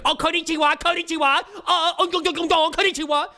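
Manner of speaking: very high, loud, laughing mock greeting; mocking caricature voice. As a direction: shriek it like a cartoon caricature. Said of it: "be like".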